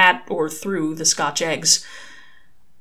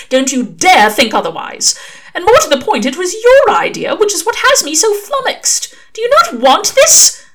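much higher, louder indignant angel; 13 semitones higher, 9 dB louder than usual. Acted. Angel insists Crowley's idea was good, loud and indignant. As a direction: insist fiercely.

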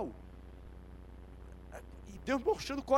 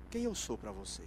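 low, softer, personal question; Jesus asking softly; 11 semitones lower, 7 dB quieter than usual. Acted. Jesus asking the roughest disciple, low and quiet. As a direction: drop low and ask it intimately. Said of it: "e ele disse".

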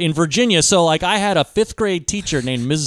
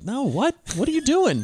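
incredulous; 8 semitones higher, 4 dB quieter than usual. Retold or imitated. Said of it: "you're like".

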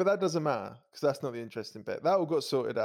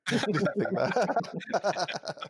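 laughing; 6 semitones higher, much slower than usual. Real, not acted. Jokes, then takes it back.

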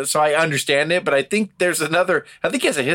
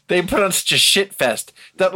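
ordinary talk

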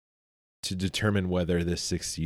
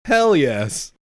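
excited; 8 semitones higher, 5 dB louder than usual. Real, not acted. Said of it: "I've been waiting to do this for so long".

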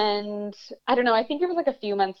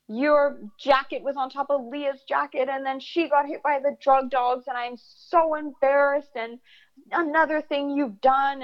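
higher-pitched mock parental telling-off; retold scolding dad; 5 semitones higher than usual. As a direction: reel off accusations like an exasperated father. Dad angrily scolding son, retold.

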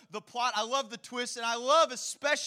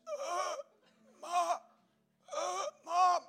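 breathless call for mom; 7 semitones higher, much slower than usual. Retold or imitated. Winded boy calling mother.

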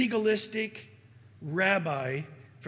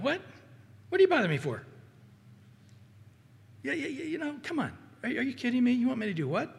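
mock annoyed Lord; 4 semitones higher, much faster than usual. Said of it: "The Lord going".

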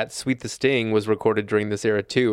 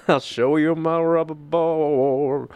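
slow sultry [name] impression; 4 semitones higher, slower than usual. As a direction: vamp it like [name]. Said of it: "become [name] when singing".

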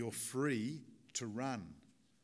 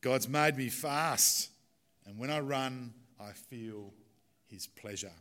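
slow, loud Scottish accent, rolled out like a Scottish runner; a Scottish missionary quoting his famous line in thick Scottish accent; 5 dB louder, slower than usual. Shown as a demonstration. Preacher quoting Eric Liddell to his church, thick Scottish accent. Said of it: "thick Scottish accent".

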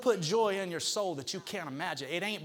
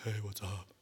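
low, soft, glum hey; gloomy guy walking into the room; dejected greeting; 9 semitones lower, 8 dB quieter than usual. Retold or imitated. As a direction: mope through the greeting. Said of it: "And I go".

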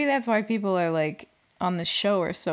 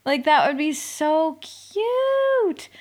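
gushing; 7 semitones higher, 4 dB louder, much slower than usual. Real, not acted.

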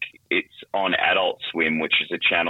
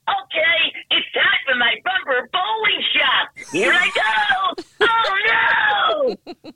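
very high, slow cartoon voice that ends in a shout; squeaky mascot heading for the pins; cartoon bowling ball; 3 dB louder, much slower than usual. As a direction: play Billy the Ball, cheerful, then panicking as he rolls. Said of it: "he'd say".

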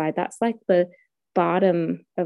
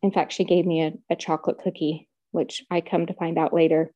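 usual speaking voice

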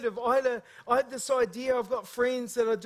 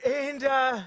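usual speaking voice

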